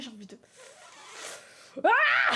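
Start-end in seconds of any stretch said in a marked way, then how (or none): 1.8-2.3 sudden high, loud mock scream; piercingly high burst of acted exasperation; exasperated scream. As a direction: scream back at a crying baby. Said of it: "Ils crient là comme ça dans mes oreilles".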